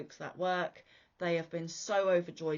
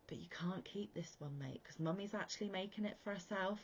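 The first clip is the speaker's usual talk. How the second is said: whispered to son; 9 dB quieter than usual. Retold or imitated. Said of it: "And I went".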